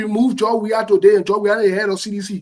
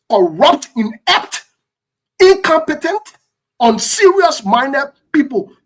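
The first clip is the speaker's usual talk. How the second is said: each word punched, rising higher; emphatic list; 4 semitones higher, 4 dB louder, slower than usual. Real, not acted. Denouncing the country's leaders, each insult hammered. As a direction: spit out each insult separately.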